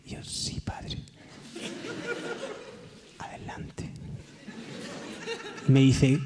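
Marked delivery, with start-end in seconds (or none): 0.2-3.9 a very soft solemn whisper; whispered reply. Retold or imitated. Son whispering solemnly to his dying father. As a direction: answer in a faint hush.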